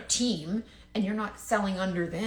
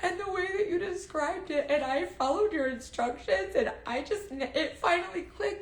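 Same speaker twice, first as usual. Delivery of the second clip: high, teary, hammed-up sobbing gush; breaking into a high, crying, gushing voice; mock crocodile tears; 10 semitones higher than usual. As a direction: overdo the weepy thanks like fake tears. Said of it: "she's crying, like, crocodile tears".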